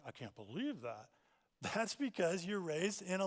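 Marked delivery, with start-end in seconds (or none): 0.1-1.1 softer, lower muttered disbelief; mock disbelief. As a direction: scoff under your breath. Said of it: "you're like".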